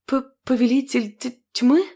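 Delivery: stunned, stammering question; stammering in shock. Acted. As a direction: stutter out in disbelief. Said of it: "удивлённо посмотрела".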